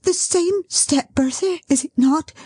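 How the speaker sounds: high, rising breathless thrill; breathless building. Acted. Dot counting down the son's arrival, breathless and high. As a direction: build as the steps come closer. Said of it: "so fluttered and distressed that she could scarcely breathe".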